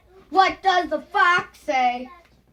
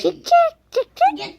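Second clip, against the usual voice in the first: high, rising chick chick call; calling chickens; 11 semitones higher than usual. Pretend. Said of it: "What kind of animal is that?".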